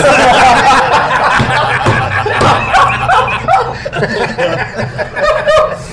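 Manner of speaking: long high-pitched laughter; high rising laugh. Real, not acted. Long laugh after joke.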